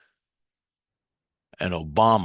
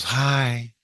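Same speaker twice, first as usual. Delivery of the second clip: lightly voiced higher girl; a hint of a girl's high greeting; girl in his head; 4 semitones higher than usual. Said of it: "She goes".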